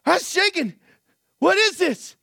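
high-pitched, bewildered shout, acted out; imitated yell. Preacher acts out Red shaking during the service, alarmed yell. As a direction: cry out as a man overwhelmed by his own trembling. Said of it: "he yells at the top of his lungs".

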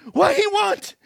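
high, urgent question, acted out; imitated yell. Preacher acts out Red asking Joe about God, urgent yell. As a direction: call it out like a bewildered man demanding answers. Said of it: "he yells at the top of his lungs".

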